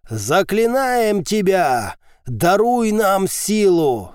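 slow, high ritual chant; spat-out incantation. A narrator's reading. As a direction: spit the words out like a cult priest. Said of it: "словно выплюнул из-под капюшона Антера".

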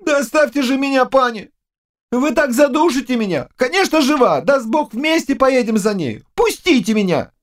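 high-pitched, smothered protest; flustered young servant. Acted. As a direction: protest as if squeezed in a hug. (юный слуга возмущается высоким голосом)

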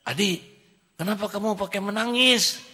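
put-on high, loud child asking; child's voice. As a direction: ask like a curious small child. Child asks crying friend. (suara anak kecil bertanya)

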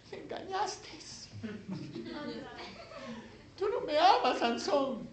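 high falsetto whine; mock hurt feminine voice, very high; wounded reproach. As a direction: pout and whine like a betrayed girlfriend. Dalila reproaches Sansón a second time.

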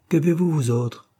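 a storyteller's moderate shout; narrated cry. A narrator's reading. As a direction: call out across the room, restrained. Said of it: "cria une troisième voix".

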